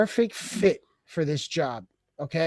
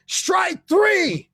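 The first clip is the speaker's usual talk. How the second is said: high-pitched, drawn umpire's cry; referee's call; 10 semitones higher than usual. Acted. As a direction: call the strikeout to the stadium. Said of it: "the referee calls strike".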